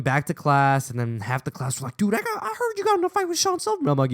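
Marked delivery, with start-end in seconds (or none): 2.0-3.9 high, rapid mock-schoolkid chatter; excited classmates. Classmates quiz him about the fight, excited high-pitched rush. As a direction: gossip in a squeaky rush.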